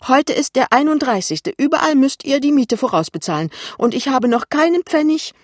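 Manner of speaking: high, loud angry insistence; furious. A narrator's reading. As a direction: press the demand with rising outrage. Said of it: "plötzlich wütend und ist mitten in ihrem Thema".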